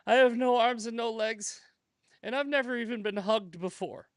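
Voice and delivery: high, plaintive retelling of a crying woman; mock tearful. Crying woman to passer-by on the beach, mock tearful. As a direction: plead pitifully, acted for a joke. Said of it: "and she's crying".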